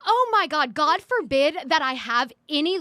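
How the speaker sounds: loud, high exasperated outburst; heated. Real, not acted. Podcaster defending her league credibility, heated. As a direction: push it out in indignant sarcasm. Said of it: "You're starting to yell right now".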